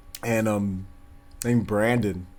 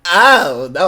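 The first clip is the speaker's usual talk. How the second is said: loud mock-dismayed "oh, no"; big drawn-out mimicry of dismay; overreacting friend; 3 semitones higher, 8 dB louder than usual. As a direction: imitate an over-the-top catchphrase. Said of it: "He used to always go".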